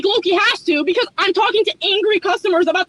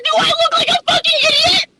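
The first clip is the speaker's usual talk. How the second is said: indignant shout; 7 semitones higher than usual. Pretend. Outburst at server.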